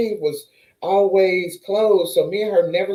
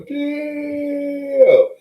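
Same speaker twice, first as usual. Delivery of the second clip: mock dismay; 10 semitones higher, 4 dB louder than usual. Friend's reaction on FaceTime.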